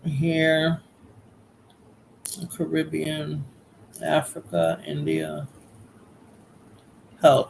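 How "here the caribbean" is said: usual speaking voice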